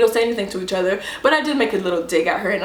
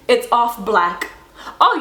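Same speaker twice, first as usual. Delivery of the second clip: ordinary delivery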